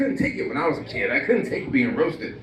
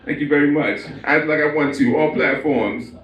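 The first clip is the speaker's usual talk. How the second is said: Jay-Z speech. Acted. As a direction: brag about yourself like a star. Jay-Z self-love speech.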